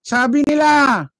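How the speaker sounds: high, drawn-out mocking sabi nila; mocking singsong. Vlogger mocking the rally speakers' claims, high mocking singsong. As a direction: parrot them with a mocking lilt.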